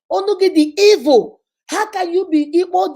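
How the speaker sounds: high, loud mock indignant husband; angry husband. Husband blaming wife in counseling, high loud. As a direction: insist hotly on her temper. Husband justifying hitting, voiced.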